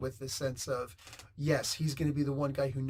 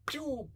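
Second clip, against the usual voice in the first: high, light pew sound effect; imitated web shot; 11 semitones higher, 4 dB quieter than usual. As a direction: shoot out a quick high pew. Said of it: "Like that".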